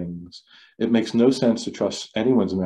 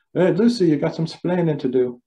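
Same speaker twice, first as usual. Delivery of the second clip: bad Cuban accent; 7 semitones higher than usual. Lecturer quoting the TV husband to his class, in a bad Cuban accent. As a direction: ham up a bad Cuban accent, scolding Lucy. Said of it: "Sorry for the bad accent".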